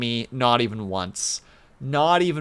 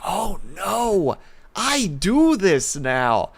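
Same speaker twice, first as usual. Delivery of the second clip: mock dismay; 7 semitones higher, much slower than usual. Mock dismay at own habit.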